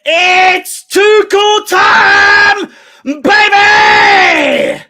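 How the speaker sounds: high, loud, long-drawn words; triumphant catchphrase yell. Real, not acted. Fan hailing manager Tuchel after the comeback, triumphant yell. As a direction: stretch every word into a victory roar.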